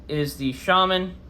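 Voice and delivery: ordinary delivery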